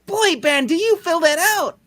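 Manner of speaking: high falsetto swoon; breathy-free high girlish gush; swooning woman voice. Acted. Swooning over Ben.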